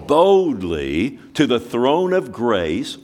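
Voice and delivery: slow, weighty "Boldly to the throne of grace"; boldly, slowly. Shown as a demonstration. Preacher stressing each word to the congregation. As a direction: lean on "Boldly", stretched out.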